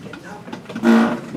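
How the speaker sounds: slow, breathy whisper so you can barely hear it. Shown as a demonstration. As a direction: whisper it slowly to the class. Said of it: "let's do this with a whisper voice".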